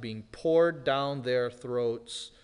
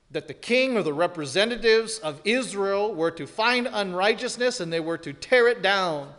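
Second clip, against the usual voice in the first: higher, louder pulpit drive; rising preaching; 5 semitones higher, 6 dB louder than usual. Acted. Preacher explaining Israel's legal system, driving and raised. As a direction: push the point hard to the back row.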